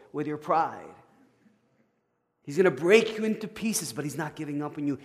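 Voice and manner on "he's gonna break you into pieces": forceful preaching. Real, not acted.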